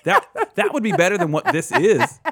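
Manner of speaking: high, loud words through real laughter; laughing hard. Real, not acted. Host reacts to co-host's wrong guess, laughing hard. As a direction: crack up while trying to answer.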